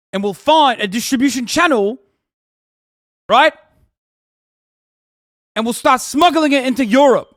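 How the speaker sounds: loud, high, swaggering top G voice; puffed-up mock Taliban bro bragging to Ahmed; hammed-up Taliban hustler. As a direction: brag like a wannabe Andrew Tate. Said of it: "they're like".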